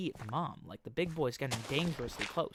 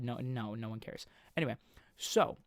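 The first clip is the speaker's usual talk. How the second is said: ordinary talk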